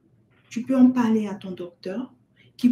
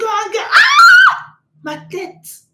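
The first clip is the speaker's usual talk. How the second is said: exasperated mother; 8 semitones higher, 13 dB louder, much slower than usual. Acted. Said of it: "Tu entends".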